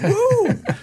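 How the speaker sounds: a very high, whooping camp cheer; excited woo. Retold or imitated. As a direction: throw out a wild high woo like at drum camp. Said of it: "I, I'm like".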